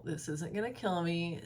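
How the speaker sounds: normal talking voice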